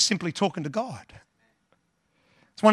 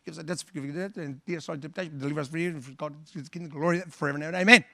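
rattled off fast and low, fading away; mindless rote prayer; 3 semitones lower, 4 dB quieter, much faster than usual. Retold or imitated. Pastor mocking churchgoers, rushing through words by rote. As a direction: gabble the prayer like empty repetition. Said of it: "we think that God's impressed with just repetition".

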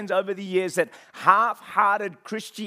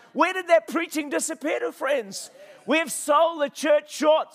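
impassioned, higher-pitched sermon delivery; full-force preaching; 5 semitones higher, 5 dB louder than usual. Real, not acted. Preacher urging the church to preach the whole gospel, full-force preaching. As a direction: preach it hard with driving, hammered phrases.

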